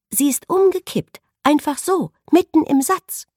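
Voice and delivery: high, loud, rattled burst of alarm; blurting it out in shock, voice high; frightened. Acted. Assistant reporting the collapse to the doctor, high and frightened. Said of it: "der der Schreck ordentlich in die Glieder gefahren war".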